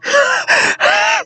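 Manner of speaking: high-pitched burst of laughter; high giggle. Real, not acted. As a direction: squeal of laughter. Reacting to the creepy character line.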